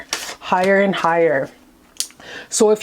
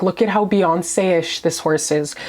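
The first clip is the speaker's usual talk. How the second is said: usual speaking voice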